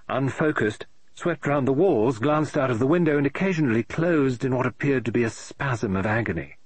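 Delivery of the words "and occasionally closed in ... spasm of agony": usual speaking voice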